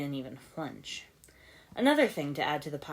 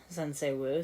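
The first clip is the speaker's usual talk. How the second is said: ordinary delivery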